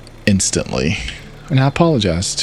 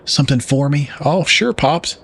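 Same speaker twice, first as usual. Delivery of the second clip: put-on Southern accent; 6 semitones higher than usual. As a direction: ham up a Southern country uncle. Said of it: "I gotta do my southern voice".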